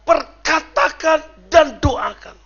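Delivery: loud, high, very slow declaration; emphatic preaching command. Real, not acted. Preacher urging believers to speak it and pray, slow loud exhortation. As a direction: hammer out each word to the congregation.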